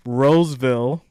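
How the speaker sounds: ordinary talk, nothing marked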